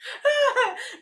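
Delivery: high burst of real laughter. Real, not acted. Laughing at a viewer's comment, high and bright.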